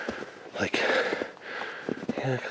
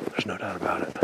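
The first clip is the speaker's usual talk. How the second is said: whispering; 3 semitones lower, 6 dB quieter than usual. Real, not acted. Hushed discovery of structure.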